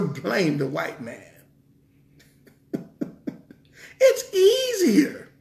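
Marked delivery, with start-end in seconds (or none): 4.0-5.3 much higher, slow mocking falsetto; sarcastic high-pitched drawn-out mockery. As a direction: stretch it out high like a mocking excuse. Mocking the excuse people make.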